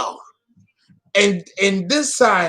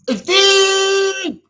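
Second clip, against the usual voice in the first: a high, wailing cry of it; crying shout; 14 semitones higher, 3 dB louder than usual. Acted. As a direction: throw it up high in a dramatic wail. Repeats phrase building to a cry.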